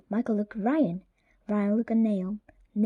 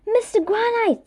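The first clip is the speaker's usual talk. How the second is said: joyful greeting; 11 semitones higher, 3 dB louder than usual. A narrator's reading. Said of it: "said Mister Granite".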